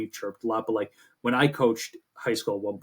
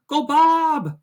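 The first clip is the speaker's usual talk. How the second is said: much higher, loud cheering yell; fan scream; 8 dB louder than usual. Retold or imitated. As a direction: scream it across the ballpark like a rowdy fan. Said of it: "just, like, screaming".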